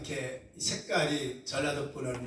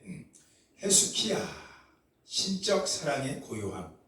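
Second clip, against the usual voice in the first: ordinary delivery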